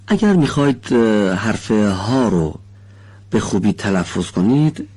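usual speaking voice

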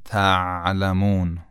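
"تعلمون" with a soft, drawn-out ayn after ت. Shown as a demonstration. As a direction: let the throat sound stretch in "تعلمون", unbroken. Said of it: "خیلی نرم". («تعلمون» با عین نرم و کشیده)

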